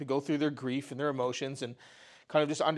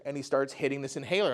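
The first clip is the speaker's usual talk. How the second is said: normal talking voice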